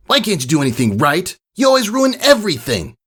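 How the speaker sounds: high, far-off bully yelling at a filly; a bully colt yelling insults at the crying filly, high and angry; shouting bully. Acted. Bully colt yelling at the crying filly, high and angry. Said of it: "He was shouting at a unicorn filly".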